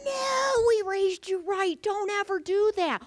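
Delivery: retold stern warning from the parents; firm parental scolding. Parents scolding daughter at dinner.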